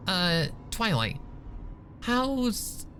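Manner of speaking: high, forced cheerful question; awkwardly chirpy topic change. A narrator's reading. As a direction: paper over the awkward silence with a bright voice. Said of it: "trying to move away from the awkward exchange".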